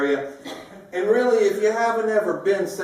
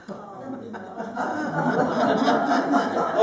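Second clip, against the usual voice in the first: laugh; 6 semitones higher than usual. Real, not acted. Audience rain punchline.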